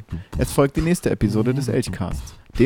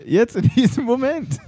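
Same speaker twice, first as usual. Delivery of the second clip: pitched-up, pressing outburst; urgent high exclamation; 10 semitones higher than usual. Acted. Curator urging the director to call police, high and pressing. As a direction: demand it right now, voice shooting up.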